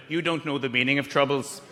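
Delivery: put-on low Northern Irish accent; lightly voiced Northern Irish receptionist. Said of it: "the woman on reception went".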